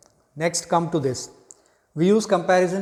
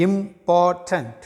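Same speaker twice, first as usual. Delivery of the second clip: syllables drawn out; 2 semitones higher, much slower than usual. Shown as a demonstration. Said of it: "counting syllables".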